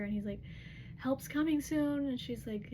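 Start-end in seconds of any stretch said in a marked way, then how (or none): 1.0-2.0 higher, louder and drawn out; urgent assurance. Retold or imitated. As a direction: imitate urgent reassurance, stretched and raised. Retold urgent assurance over phone.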